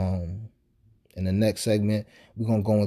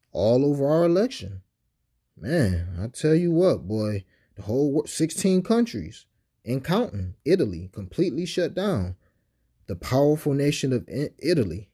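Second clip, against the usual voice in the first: higher-pitched mock amazement; sarcastic; 4 semitones higher than usual. Real, not acted. Podcaster mocking the idea countries shut down over our election, sarcastic. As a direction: lay the sarcasm on thick, pitch swooping up. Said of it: "You sense the sarcasm in my voice".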